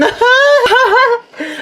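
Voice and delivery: shrieking laugh; high shrill laugh. Acted. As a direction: cackle at the prank. Laughs after prank story.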